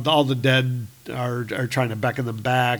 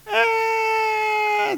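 sudden high scream. Acted. Scream cutting off toast.

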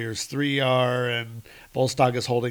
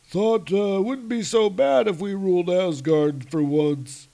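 sheepish giant; 7 semitones higher, 4 dB louder than usual. Acted. Dazed giant, voice changed.

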